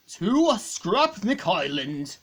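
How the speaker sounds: slow, drawn-out proclamation; robot presenting the island, slow and grand; grand announcement. Acted. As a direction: unveil the island with ceremony.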